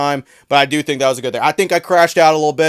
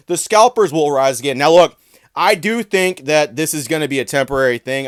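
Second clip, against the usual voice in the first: ordinary delivery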